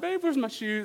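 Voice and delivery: high-pitched tearful whine, hammed-up; playfully exaggerated sobby plea; mock crying. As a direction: pretend to be near tears, pleading. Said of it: "So I'm crying".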